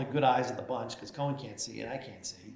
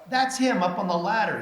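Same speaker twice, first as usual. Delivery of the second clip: louder, higher gotcha line; gleeful reveal; 7 semitones higher, 7 dB louder than usual. Retold or imitated. The speaker telling the new pro that the manager is on the ladder, loud and gleeful. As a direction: land the joke with a grin.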